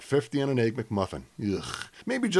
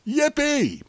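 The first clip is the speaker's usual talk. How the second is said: mock cheer; 3 dB louder than usual. Sarcastic cheer.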